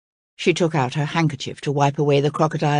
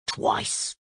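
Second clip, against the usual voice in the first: whispered, lower crocodile aside; 8 dB quieter than usual. Acted. Crocodile admits to Cora in a soft whisper. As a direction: drop the word out softly, unvoiced. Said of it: "cried Princess Cora".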